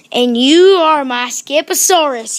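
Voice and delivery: loud, proud proclamation. A narrator's reading. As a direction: announce it out loud to your creation. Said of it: "he added out loud".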